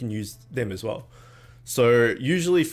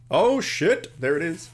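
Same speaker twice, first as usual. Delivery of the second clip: slow high oh shit; startled realization; surprised; 6 semitones higher, 3 dB louder, slower than usual. Real, not acted. Stream glitch reaction.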